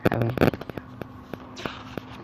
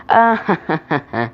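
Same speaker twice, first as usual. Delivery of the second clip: mock laugh that drops away; acted laugh; 4 semitones higher, 3 dB louder than usual. A narrator's reading. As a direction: burst of put-on laughter starting high. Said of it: "Sam just laughed".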